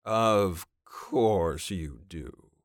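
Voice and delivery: very slow, sneering drawl. A narrator's reading. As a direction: drag it out with contempt. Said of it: "Fraser drawled".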